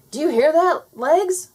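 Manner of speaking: high, loud panicky wail; frightened wail. Acted. Garfield wails at his frozen legs, high and panicky. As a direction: cry out to your own legs in terror. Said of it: "wailed Garfield".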